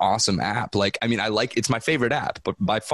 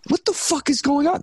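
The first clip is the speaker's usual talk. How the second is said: incredulous. Real, not acted. Baffled outburst about TikTok.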